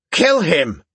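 high, panicky whine from a coward; frightened whining shriek. A narrator's reading. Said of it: "a frightened, whining voice shrieked".

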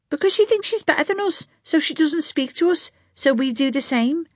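high, quick indignant schoolgirl; a huffy girl defending herself; young girl voice. A narrator's reading. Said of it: "Josephine justifies herself".

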